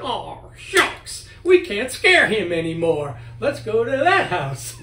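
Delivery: loud, laughing ghost grumbling; mock ghost voice. A spirit complaining to the cemetery ghosts, mock disappointed. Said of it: "that spirit went to the cemetery and said".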